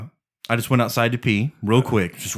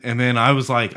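usual speaking voice

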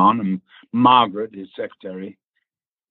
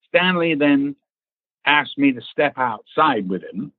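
ordinary delivery

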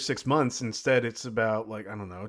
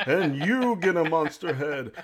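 Oprah impression; 4 semitones higher than usual. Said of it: "I'm imitating Oprah?".

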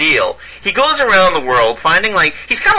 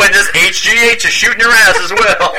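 Tony the Tiger voice; 5 semitones higher than usual. Retold or imitated. Voicing Tony at sports events.

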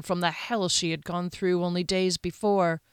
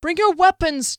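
high, loud urgent order; alarmed shout to the company; shouted command; 9 semitones higher, 9 dB louder than usual. Acted. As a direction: cry out a call to arms. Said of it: "Duskellen cried".

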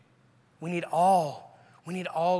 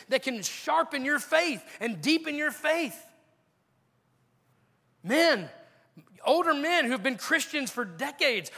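high-pitched, punchy preacher's challenge; impassioned preaching; 9 semitones higher than usual. Real, not acted. Preacher challenging older and younger church members, impassioned. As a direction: press each question on the congregation.

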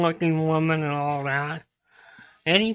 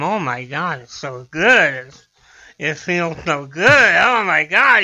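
mock pleasure; 4 semitones lower than usual. Man in a joke enjoying it this time, mock blissful moaning. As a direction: draw it out in hammed-up bliss. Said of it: "And, and this time".

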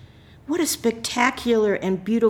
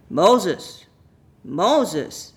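mildly raised storybook call; loud voice; 5 dB louder than usual. A narrator's reading. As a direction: call out the name, lightly voicing God. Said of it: "said a loud voice".

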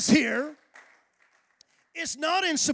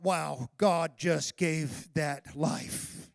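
hushed awe; 9 semitones lower, 6 dB quieter, much slower than usual. Retold or imitated. Awe at a birth.

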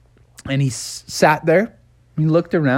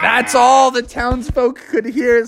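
ordinary delivery, nothing marked

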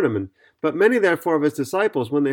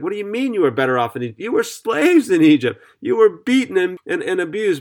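mock outrage, jumping higher on "slaves"; incredulous retort; 5 semitones higher than usual. Preacher answers the Israelites who miss Egypt, in mock disbelief.

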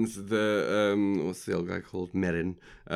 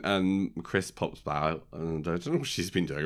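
ordinary delivery

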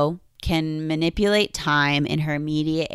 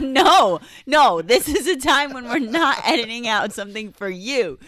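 laughing refusal; 6 semitones higher, 4 dB louder than usual. Real, not acted.

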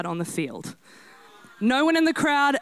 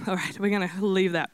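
normal talking voice

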